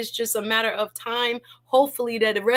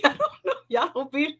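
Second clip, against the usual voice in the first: laughing; 3 semitones higher, faster than usual. Real, not acted. Laughing after MVP.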